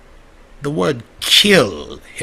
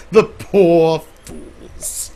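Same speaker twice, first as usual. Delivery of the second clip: gloating; 6 semitones higher, 4 dB louder, much slower than usual. Acted. Villainous character gloats.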